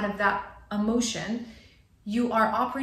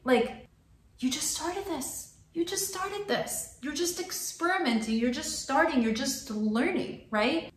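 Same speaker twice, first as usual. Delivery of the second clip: higher, gentle encouraging pep talk; reassuring friend; 5 semitones higher, slower than usual. Retold or imitated. To her frustrated friend who wants to quit, higher reassuring voice. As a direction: coax him not to give up. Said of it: "But I'm like".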